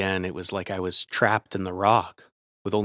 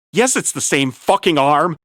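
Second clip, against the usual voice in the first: loud, high furious yell; angry yell; 8 semitones higher, 11 dB louder than usual. Acted. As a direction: yell it back in anger. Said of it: "he yelled".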